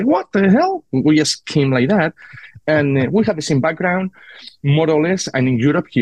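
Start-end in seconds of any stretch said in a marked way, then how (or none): 0.0-0.8 high-pitched mock disbelief; retold astonishment. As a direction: act out being taken aback, voice jumping up. Retold reaction to the name.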